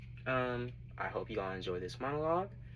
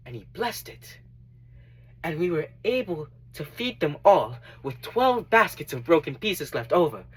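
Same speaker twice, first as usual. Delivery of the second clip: earnest dramatic monologue, higher than everyday talk; Matthew; 4 semitones higher, 9 dB louder than usual. Acted. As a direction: give a heartfelt testimony, pausing between phrases. Performs Matthew's testimony monologue.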